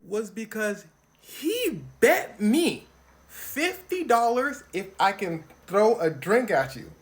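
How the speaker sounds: normal talking voice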